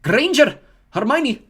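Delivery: loud, high name call. Acted. McGonagall calls a pupil forward, loud and high. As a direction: call the name across the hall. Reading names to the hall.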